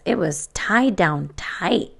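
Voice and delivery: plain reading voice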